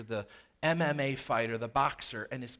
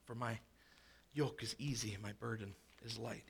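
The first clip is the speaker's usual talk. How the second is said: soft tender voice; 6 semitones lower, 12 dB quieter than usual. Acted. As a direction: lower and much softer, tender. Jesus' words voiced softly.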